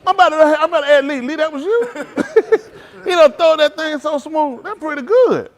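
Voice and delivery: high, loud talk through laughter; real laughing fit. Real, not acted. Preacher tickled by someone's smooth trick, loud real laughing.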